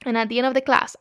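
ordinary talk

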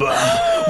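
big high theatrical gasp; shocked gasp. Acted. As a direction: gasp loudly in mock shock.

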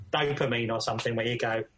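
usual speaking voice

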